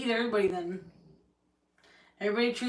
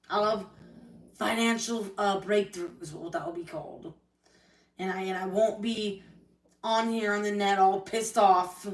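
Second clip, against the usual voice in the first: normal talking voice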